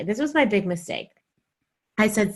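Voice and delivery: ordinary talk, nothing marked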